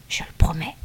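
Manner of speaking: dying elder's whispered promise. A narrator's reading. Dying elder promises his visitor, whispered. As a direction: whisper it as a weak assent.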